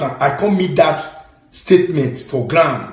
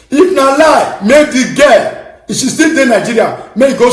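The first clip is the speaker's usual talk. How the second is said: laughing dare; 6 semitones higher, 5 dB louder than usual. Real, not acted. Daring challenge with a laugh.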